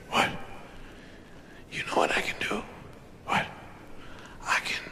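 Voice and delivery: conspiratorial whisper. Acted. Boy whispers his bed-jumping idea to brother. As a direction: whisper the secret plan.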